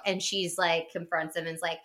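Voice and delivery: ordinary delivery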